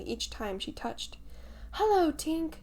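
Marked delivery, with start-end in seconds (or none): 1.7-2.5 high, louder greeting to the sky; wondering call. A narrator's reading. Boys calling up to the fairy overhead, wondering. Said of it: "cried the wondering boys".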